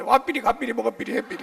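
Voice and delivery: loud playful mock Gyeongsang with 삐리 endings; Gyeongsang dialect. Shown as a demonstration. Preacher joking how spies fake Gyeongsang dialect, mock Gyeongsang. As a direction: tack 삐리 on each verb in Gyeongsang style. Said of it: "삐리만 넣으면 돼".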